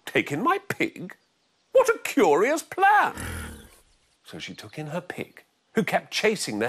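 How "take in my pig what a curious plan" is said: put-on high, loud astonished old woman; old lady voice. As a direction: gasp it like a shocked old lady.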